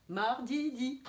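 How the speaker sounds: MARDIDI with the last DI said twice, distinctly; repeated final syllable. Shown as a demonstration. Teacher doing phonology with pupils, MARDIDI with repeated ending. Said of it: "On dit plusieurs fois la syllabe finale".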